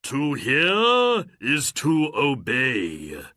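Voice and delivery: slow, loud, grand genie voice; booming genie. Acted. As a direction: announce yourself like a mighty spirit. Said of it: "the genie said".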